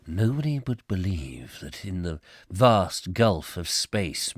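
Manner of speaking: slow, low gravelly narrator voice; low gravelly War of the Worlds narrator; dramatic narrator. Shown as a demonstration. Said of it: "gravelly voice now to do that".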